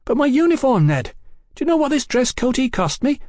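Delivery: high, loud, aggrieved complaint; outraged high-voiced objection; indignant protest. A narrator's reading. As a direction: wail in protest about it, pitched very high. Brian protests about his uniform.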